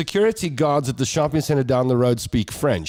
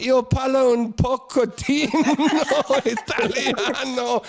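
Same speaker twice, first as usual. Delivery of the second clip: Io parlo un pochettino Italiano, higher, slow, laughing; flustered Italian; 11 semitones higher, much slower than usual. Retold or imitated. Host panicking in Italian to a French driver, slow and laughing. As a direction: stumble into Italian slowly while laughing. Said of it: "I'm like".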